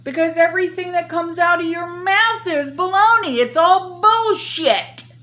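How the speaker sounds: loud, high, heated tirade; angry rant. Real, not acted. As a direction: tear into him, furious. Ranting at the official.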